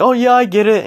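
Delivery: a put-on chirpy voice mocking classmates who understood; mock breezy know-it-all. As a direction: toss it off like the smug one who gets it. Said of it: "people are like".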